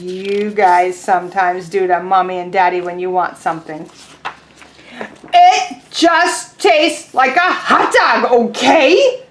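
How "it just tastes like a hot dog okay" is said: loud, slow, then trailing off; annoyed pigeon. Acted. Teacher voicing Pigeon irritably answering the duckling, loud then fading. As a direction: snap the answer, then give in.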